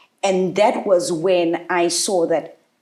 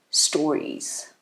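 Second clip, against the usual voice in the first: ordinary delivery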